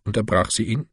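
ordinary delivery, nothing marked

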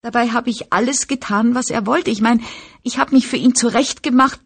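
put-on high whine, helpless girl complaining; whining voice. A narrator's reading.

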